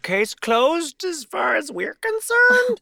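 high, very slow stiff official voice; mock official statement. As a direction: drag it out like a pompous bureaucrat. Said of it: "so we just have to be like".

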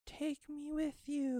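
put-on high, slow eerie child's voice; a tiny ghostly child pleading; creepy child voice. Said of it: "as if a child were speaking".

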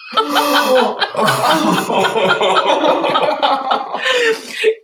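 long, open real laugh; hearty laugh. Real, not acted. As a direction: burst out laughing at the memory. Laughing at family story.

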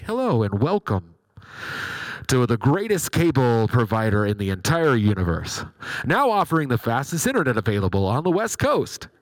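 a hint of a canned corporate welcome; cable company greeting. Cable company recording welcoming the caller, a touch salesy. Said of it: "more impressions of DirecTV people".

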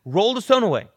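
Jesus commanding loudly at the tomb. Retold or imitated. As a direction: order them to roll it away.

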